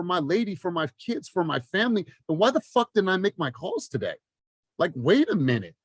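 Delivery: high, fired-up self-callout; passionate. Real, not acted. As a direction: scold yourself awake, pitch climbing. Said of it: "I'm just passionate about this".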